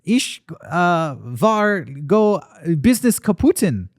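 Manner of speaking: lightly put-on German accent; broken-English German accent; movie villain german accent. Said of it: "I'm trying to do a German accent".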